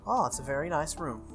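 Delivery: normal talking voice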